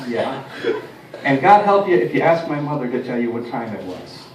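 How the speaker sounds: ordinary talk, nothing marked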